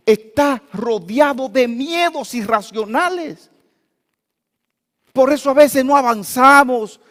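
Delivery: loud, high, fervent sermon voice; preaching at full force. Acted. Pastor exhorting the congregation against fear, fervent full-voiced preaching. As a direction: drive the warning about fear home to the church.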